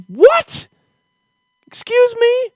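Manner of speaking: hammed-up offence at ketchup-only hot dogs; outraged shriek.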